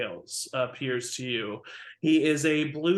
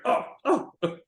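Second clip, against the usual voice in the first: frightened mad dragonborn; 11 semitones higher, 3 dB louder than usual. Acted. Drake answers from behind the door.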